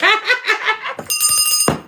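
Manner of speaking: high squealing burst of laughter; shrieking laugh. Real, not acted. Tarot reader shrieking with laughter at her own joke. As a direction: let a piercing giggle fly out.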